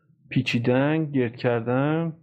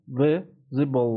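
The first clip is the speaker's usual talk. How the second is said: slowed right down; much slower than usual. Shown as a demonstration. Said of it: "teacher slows the word down".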